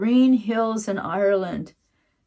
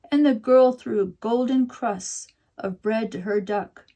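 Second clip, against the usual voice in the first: usual speaking voice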